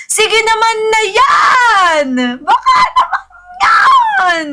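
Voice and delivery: high, drawn-out begging wail; wailing plea. Real, not acted. Streamer begging viewers for a birthday gift, wailing plea.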